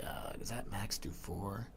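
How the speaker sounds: low, soft puzzled mumble to himself; half-swallowed doubtful aside; unsure mutter. Retold or imitated. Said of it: "I wouldn't be like".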